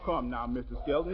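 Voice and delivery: normal talking voice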